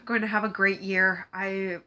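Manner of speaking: normal talking voice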